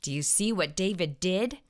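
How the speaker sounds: ordinary delivery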